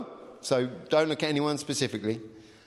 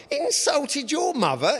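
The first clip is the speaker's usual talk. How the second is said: high, disbelieving exclamation; incredulous; 10 semitones higher than usual. A narrator's reading. Gentleman questioning Sim's claim, incredulous and high. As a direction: gasp it out in disbelief. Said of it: "exclaimed the gentleman incredulously".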